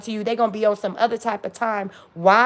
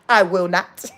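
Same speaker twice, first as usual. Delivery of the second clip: low, firm refusal; said with chest; 4 semitones lower than usual. Shown as a demonstration. Coaching listeners to say no, firm from the chest. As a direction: say it from the chest, deliberately. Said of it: "You say it with your".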